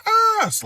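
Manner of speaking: a loud, very high shriek; scary movie scream. Retold or imitated. Nurse seeing him bleeding on the ground, loud high shriek. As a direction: scream like a horror film victim. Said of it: "like, scream so loud, like a scary movie scream".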